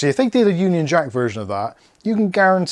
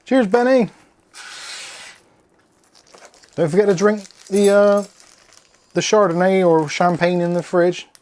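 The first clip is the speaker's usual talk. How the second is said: higher, louder farewell call; 5 semitones higher, 3 dB louder than usual. Real, not acted. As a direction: call after him as he leaves. Calling out to departing Benny.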